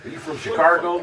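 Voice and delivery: imitated Cincinnati question with "Shi-CAR-go"; Cincinnati pronunciation. Imitating Cincinnati people asking, Cincinnati pronunciation. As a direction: ask it with a Cincinnati r in Chicago. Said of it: "they said Chicago".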